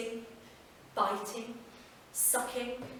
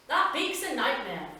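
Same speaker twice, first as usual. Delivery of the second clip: Andrew, mock shudder; 4 semitones higher, 5 dB louder than usual. Postman Andrew on the beak.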